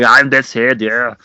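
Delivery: loud, badly faked Schwarzenegger accent; bad Arnie. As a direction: do a terrible Austrian action-star impression. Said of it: "really bad impression of Arnie".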